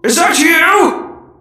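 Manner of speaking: high, loud, slow cry of recognition; surprised shout. Acted. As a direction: call out in amazed joy. Recognizes old friend, calling out.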